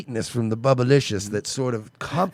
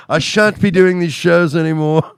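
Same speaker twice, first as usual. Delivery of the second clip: put-on slow, plummy British accent; posh British accent; 3 semitones higher, 4 dB louder than usual. Posh Gordon quote.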